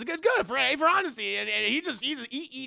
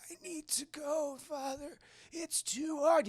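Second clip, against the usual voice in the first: put-on high, strained little-boy voice; struggling child; 10 semitones higher than usual. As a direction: plead like a weakened child. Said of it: "the kid's like".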